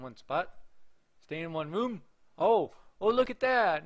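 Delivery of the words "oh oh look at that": loud, high exclamation; surprised discovery. Real, not acted. Player spotting the character in another room, surprised. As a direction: burst out on spotting it. Spots figure in new place.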